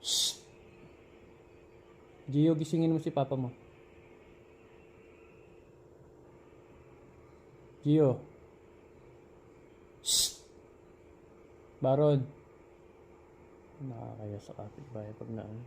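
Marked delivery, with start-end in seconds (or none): 2.3-8.2 loud high call through the door; calling to the son to wake his father. Real, not acted. 11.8-12.3 loud call of the name. Real, not acted. Calling out.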